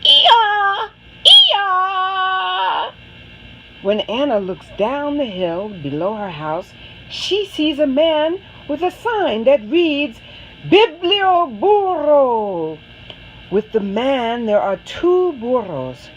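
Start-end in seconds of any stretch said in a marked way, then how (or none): 0.0-2.9 loud, high braying ee-yaw; donkey bray. A narrator's reading. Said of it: "and a loud". 10.7-12.8 slow, high, drawn-out Biblioburro; savoring the word. A narrator's reading. Reading the sign aloud.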